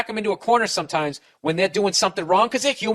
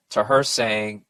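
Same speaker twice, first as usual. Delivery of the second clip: ordinary delivery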